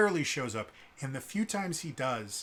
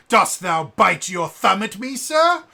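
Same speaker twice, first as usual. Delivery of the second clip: loud, high, hammed-up stage British, rising at the end; bad Shakespearean actor; 8 semitones higher, 15 dB louder, slower than usual. Quoting Romeo and Juliet to mock bad accents, overblown Shakespearean challenge. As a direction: project it like terrible Shakespeare in the Park. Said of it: "really bad Shakespeare in the Park".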